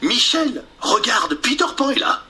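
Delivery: voix de garçon, plus aiguë; garçon excité. Acted. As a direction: montrer Peter, tout excité. Jean montre Peter à Michel. (Jean excité)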